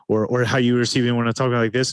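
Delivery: rushed fast talking. Shown as a demonstration. Coach contrasting with the calm take, rushed hurried voice. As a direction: rush the words like you're stressed. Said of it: "think about the difference".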